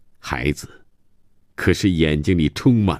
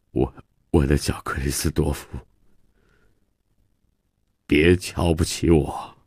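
halting, subdued plea; ashamed pleading; 2 semitones lower than usual. Acted. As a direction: plead haltingly with your child. Ashamed father pleads with his son. (羞愧地低声哀求)

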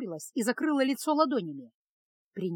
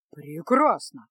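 beaming; 7 semitones lower, 5 dB louder, much slower than usual. A narrator's reading. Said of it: "просиял чародей".

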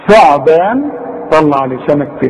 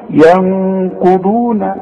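ghunna recitation; 3 semitones higher, much slower than usual. Shown as a demonstration. Teacher chants the verse showing ghunna. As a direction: chant الذين ينقضون very slowly, humming the نون for two beats. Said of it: "لا تزيد ولا تنقص".

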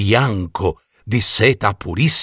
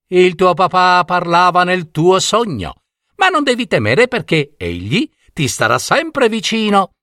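high, gentle reassurance; reassuring fox; 9 semitones higher than usual. A narrator's reading. Tatanaia reassuring Jelina about her father, gentle. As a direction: comfort the girl kindly. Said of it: "la rassicurò Tatanaia".

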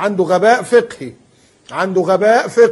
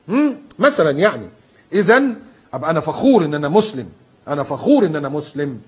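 ordinary talk, nothing marked

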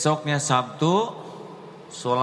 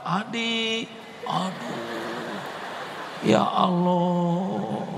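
anguished sigh; 7 semitones higher, much slower than usual. Retold or imitated. Adi left behind, heartbroken.

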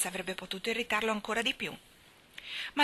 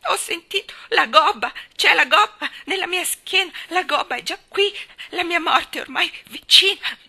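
put-on high, loud whining sick boy; frightened boy howling in self-pity; wailing boy; 10 semitones higher, 10 dB louder than usual. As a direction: wail it in despair. Boy wails about his hump.

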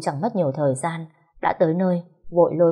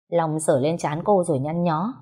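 usual speaking voice

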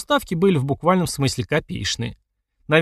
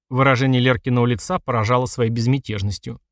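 plain reading voice

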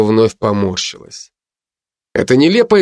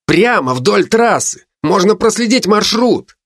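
excited; 10 semitones higher than usual. Acted. Крис горячо доказывает.

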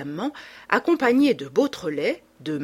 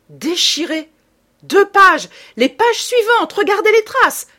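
loud, very high, furious outcry; enraged shouting; 12 semitones higher, 10 dB louder than usual. A narrator's reading. Reader discovers pages torn from the book, enraged shouting. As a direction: shout each phrase in rage and disappointment. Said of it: "tout secoué de rage et de déception".

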